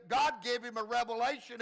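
usual speaking voice

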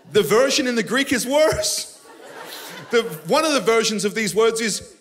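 loud, high, driving sermon voice; preaching at full force. Acted. Pastor preaching hard about being scourged, loud and high. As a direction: preach it out with fire.

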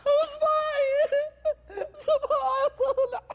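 whining lament. Acted. Deafened friend whining about finger.

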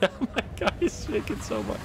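laughing through the words. Real, not acted. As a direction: chuckle while saying it. Laughing at character.

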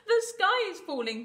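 high, loud cry of a panicked chick; panted alarm. Acted. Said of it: "panted Chicken Licken".

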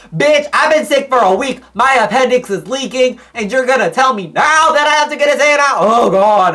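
high, loud outraged rant; sick kid ranting at doctors, high and loud; frantic rant. Retold or imitated. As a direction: rant at them in high alarm.

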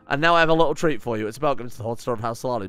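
normal talking voice

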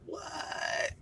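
ordinary talk, nothing marked

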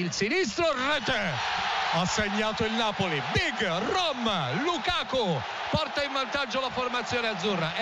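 excited goal call. Real, not acted. Penalty scored, commentator erupts.

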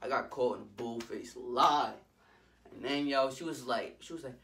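ordinary talk

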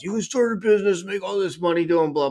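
lightly hammed-up sales hook; online ad pitch. Lightly mocking the business ads seen online. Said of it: "you get the Facebook or the YouTube ads".

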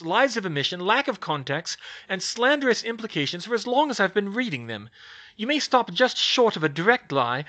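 heated scolding. Acted. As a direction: tear into her record. Hermione berating Skeeter.

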